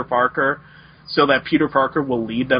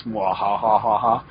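evil villain laugh; 4 semitones lower, slower than usual. Acted.

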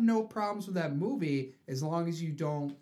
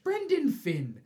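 high, loud Irish lilt; hammed-up Irish accent; mock Irish; 12 semitones higher, 6 dB louder, slower than usual. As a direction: sing out the name in stage Irish. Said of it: "Irish McIrish".

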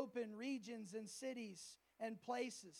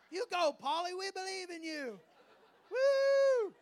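mock cheering; 7 semitones higher, 11 dB louder than usual. Cheering the small congregation.

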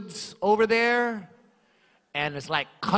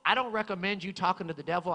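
ordinary talk, nothing marked

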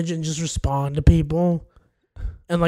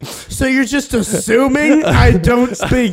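offended indignation; 6 semitones higher, 4 dB louder than usual. Acted. Offended cashier confronts customer.

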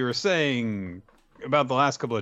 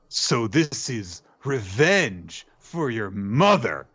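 slow, drawn-out mock-dramatic line; dramatic declaration; much slower than usual. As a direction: stretch the key words theatrically. Acts out Lucky's dramatic line.

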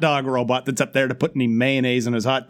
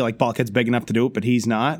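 normal talking voice

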